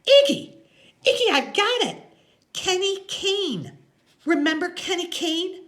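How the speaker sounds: very high, loud cry of discovery; high-pitched thrilled woman; Loretta, excited. A narrator's reading. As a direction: burst out with the answer, way up high. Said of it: "jumps up and she goes".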